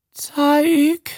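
tiny, very high squeak; squeaked peep. A narrator's reading. Trembling woman reaching for the pearls, high squeak. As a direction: peep it out eagerly. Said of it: "fiebte Lee".

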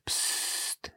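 unvoiced hushing hiss; hissed shush. A narrator's reading.

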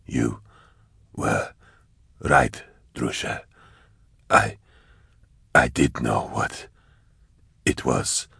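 low, soft, slow and halting; ragged dying voice. A narrator's reading. Dying hunter confessing to Drusha, ragged and weak. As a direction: labor out the words with long gaps. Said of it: "his breathing was ragged".

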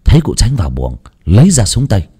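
ordinary delivery

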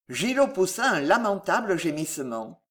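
plain reading voice

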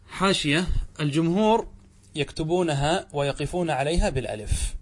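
ordinary talk, nothing marked

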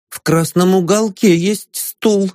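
touchy, slow and hurt; offended. Acted. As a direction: say it like a wounded host. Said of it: "обиделся Альхин". (обиженно, с нажимом на «уголке»)

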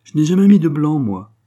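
démenti sec, haut et fort au départ; réplique sèchement en haussant la voix; réplique sèche. A narrator's reading. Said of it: "répliqua-t-elle sèchement". (protestation sèche)

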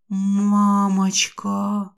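slow, drawn-out hoarse squeak. Acted. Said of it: "хрипло пропищала я".

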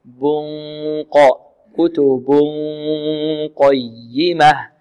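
very slow "كتب قيمة" with a held nasal hum before qaf; drawn-out recitation of "كتب قيمة", n melted into a hum; nasal ghunna. Shown as a demonstration. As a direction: stretch the hidden n from "بن" into "قا". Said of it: "مع غنة تجري من الخيشوم".

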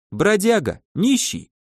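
ordinary delivery

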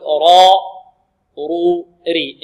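را رو ري each sound held slowly and separately; demo of ra sounds. Shown as a demonstration. Teacher drilling letter sounds for students, drawn out. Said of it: "تقول".

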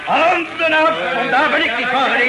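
loud, high, very slow warning; angry warning. Acted. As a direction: bark it out to keep their hands off. Captain warns off attackers.

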